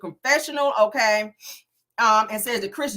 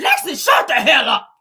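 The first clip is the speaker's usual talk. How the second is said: loud, higher-pitched mock outburst; imitated snapping; 7 semitones higher, 9 dB louder than usual. Nell snaps at Lexi chiming in, loud retold yell. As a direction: snap it loudly, acting out an angry relative. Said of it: "Nell snaps on her saying".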